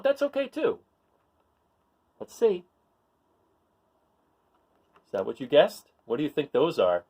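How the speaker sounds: usual speaking voice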